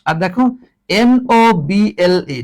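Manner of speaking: ordinary delivery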